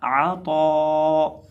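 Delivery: आ तो chanted very slowly, heavy तो; drawn-out recitation. Shown as a demonstration. Teacher joins the letters for students, slow chant. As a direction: hold the vowel of तो long and full. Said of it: "तो ज़बर अलिफ तो".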